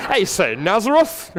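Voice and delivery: hammed-up Oxford accent, high, slow and loud; Oxford British accent. Preacher imagines Nathanael as a snob, hammed-up Oxford accent. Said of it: "Probably said it in a British accent".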